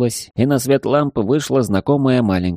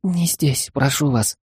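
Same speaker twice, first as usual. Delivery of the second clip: frightened babble; 6 semitones higher, slower than usual. Acted. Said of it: "испуганно залепетала".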